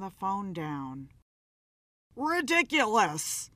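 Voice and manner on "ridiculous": screaming. Acted. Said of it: "he screamed".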